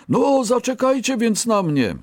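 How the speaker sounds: high-pitched urgent plea; high called plea. A narrator's reading. Third rabbit asks the others to wait, calling out. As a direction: call after them in a high voice.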